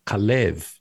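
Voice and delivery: Kalev with the accent on the second syllable; stress on second syllable. Shown as a demonstration. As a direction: lean on the last syllable of Kalev. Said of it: "the accent on the second syllable".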